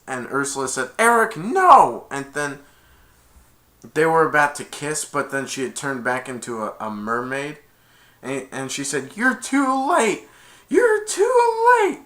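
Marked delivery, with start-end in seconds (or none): 1.0-2.0 loud, high, drawn-out protest; alarmed cry. Retold or imitated. Villain tries to stop kiss. 9.1-12.0 high, drawn-out taunt climbing to a squeal; gloating villain cry. Retold or imitated.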